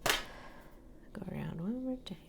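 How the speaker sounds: slow, soft whisper under her breath; whispered to herself. Real, not acted. Painter whispering instructions to herself at the canvas. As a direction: murmur it to yourself while painting. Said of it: "See what happened there?".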